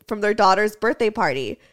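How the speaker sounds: usual speaking voice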